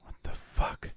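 hushed whisper; whispering while hiding. Real, not acted. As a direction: breathe it out unvoiced, hiding. Hiding quietly from intruder.

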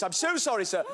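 normal talking voice

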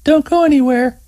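high woman's voice, playful jab; teasing grin. Acted. Terry grins as she leaves Scott hanging, high teasing voice. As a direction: tease him as you leave. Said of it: "she added with a grin".

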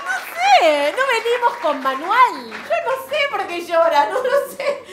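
high, loud, slow and laughing; frantic helplessness. Acted. Mother admitting she doesn't know, high and loud. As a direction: wail it like a frazzled new mom.

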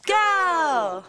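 cheer call. Real, not acted. Leading a crowd cheer.

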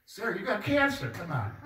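high pitched, laughing through a mock scold. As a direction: scold her while cracking up. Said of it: "One of those sounds like".

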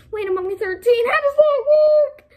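high, loud rising outburst; hammed-up bewildered shouting; mock baffled yell. Mock outburst over absurd claim.

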